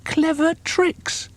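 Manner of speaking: high, slow disbelieving echo; scoffing small croc. A narrator's reading. Small croc mocking his boast, high and scornful. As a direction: throw the words back in scorn. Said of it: "cried the not-so-big one".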